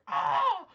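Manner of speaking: short loud high "ah" scream; crow's scream. Acted. Storyteller voicing the crow screaming at the fox, short loud scream. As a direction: imitate a screaming crow with a sudden high yelp. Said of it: "it screams at the fox".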